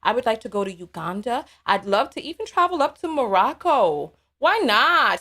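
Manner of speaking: ordinary delivery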